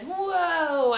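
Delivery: loud, high amazed whoa. Retold or imitated. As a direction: gasp it out in wonder. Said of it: "he's like".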